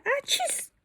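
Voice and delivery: high sneeze sound, "¡Ah, chis!". Shown as a demonstration. Teacher acting out the sneeze from the book, high. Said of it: "es un estornudo".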